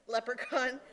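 ordinary talk, nothing marked

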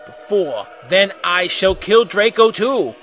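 loud, drawn-out villain proclamation; hammed-up evil overlord; evil raspy villain. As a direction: pronounce doom slowly and loudly. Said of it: "said evilly in his raspy voice".

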